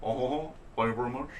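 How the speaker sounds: Elvis impression. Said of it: "I am the king, [name]".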